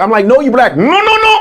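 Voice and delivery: ordinary talk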